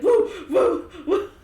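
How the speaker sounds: high, loud hammed-up howl; mock dog call. Imitating Hayden calling the dog, high and loud. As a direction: howl it like a goofy dog owner. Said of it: "He'd be like".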